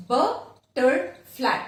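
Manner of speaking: "butterfly" slowly split as but, ter, fly; syllable by syllable. Shown as a demonstration. Teacher shows the second example, syllable by syllable. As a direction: break "butterfly" into three spaced beats. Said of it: "three syllable".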